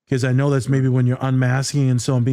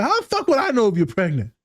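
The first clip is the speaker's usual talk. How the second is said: high incredulous outburst; exasperated; 8 semitones higher than usual. Retold or imitated. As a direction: throw it back in disbelief, higher.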